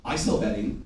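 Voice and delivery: low, soft mock protest; mock dismissive seller. Salesman self-sabotaging his sales, low and dismissive. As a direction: mutter it low, brushing it off. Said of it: "You say".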